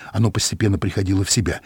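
plain reading voice

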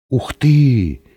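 startled little exclamation; mildly scared gasp of words; frightened. A narrator's reading. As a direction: react with a touch of alarm. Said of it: "испуганно сказал кто-то".

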